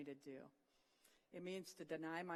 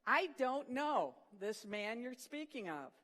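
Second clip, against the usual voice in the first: slow, loud, higher retold oath; swearing denial; 5 semitones higher, 6 dB louder, slower than usual. Peter swears to the bystanders he never knew Jesus, slow and loud. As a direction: hammer out the denial like a man cornered. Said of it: "began to invoke a curse on himself and to swear".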